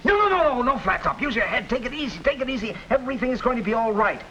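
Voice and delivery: high, fast, frantic protest, then a rushed soothing; panicky pleading. Acted. Crime boss begging the blackmailer to cool down, frantic and fast. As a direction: scramble to calm him before he talks.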